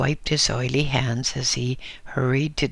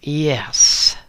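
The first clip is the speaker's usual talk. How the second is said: snigger; 2 semitones higher, 7 dB louder than usual. A narrator's reading. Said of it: "sniggered the woman with a leer".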